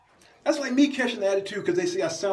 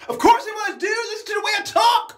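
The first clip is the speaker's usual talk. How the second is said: much higher, louder put-on voice, shouting; a put-on guy yelling in protest; 11 semitones higher, 4 dB louder than usual. Skit character defending himself.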